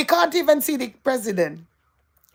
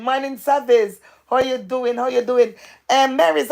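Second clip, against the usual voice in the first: ordinary delivery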